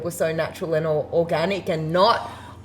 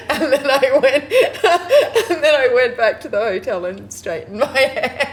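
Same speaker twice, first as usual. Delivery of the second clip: laughing through the punchline, higher; 7 semitones higher, 4 dB louder than usual. Real, not acted. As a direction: finish the story laughing. Punchline of funny story.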